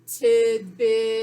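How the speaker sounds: TIDBIT stretched out loud on a level pitch; blended and held. Shown as a demonstration. Teacher models blending the sounds for pupils, blended and held. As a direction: hold both vowels of TIDBIT long, joined slowly. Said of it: "Blend and hold".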